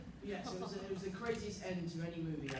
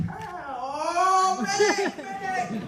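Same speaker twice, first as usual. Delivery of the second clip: high, loud cry for a medic; retold panic, yelling for help; alarmed call for help; 12 semitones higher, 13 dB louder, much slower than usual. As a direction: makeup artist shouting for a medic on set, alarmed. Makeup artist sees broken face.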